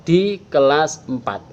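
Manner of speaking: ordinary delivery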